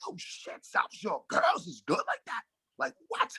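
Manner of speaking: hushed, half-whispered disbelief; amazed whisper. Retold or imitated. Watching the girls' game, to himself: stunned whisper. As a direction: breathe it out like you can't believe what you're watching. Awed courtside reaction, retold.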